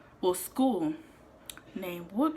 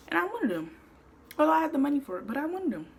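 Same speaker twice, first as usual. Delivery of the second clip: excited; 9 semitones higher, 5 dB louder, faster than usual. Retold or imitated. Excited craving retold.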